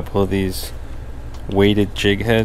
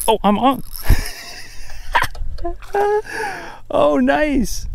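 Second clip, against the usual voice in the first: much higher, laughing with glee; delighted; slower than usual. Real, not acted. Angler laughing happily as a snapper bites. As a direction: squeal and laugh at the catch.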